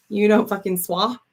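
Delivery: "swa" said short with no r, as a misspelt "swear". Shown as a demonstration. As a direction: read "swa" clipped, without the r. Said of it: "I think you mean swear".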